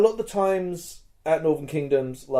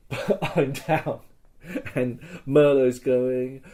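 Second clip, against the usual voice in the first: ordinary talk, nothing marked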